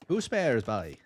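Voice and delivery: put-on joking Irish accent on "Two spares". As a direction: put on a playful Irish lilt. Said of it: "it's obviously an Irish accent".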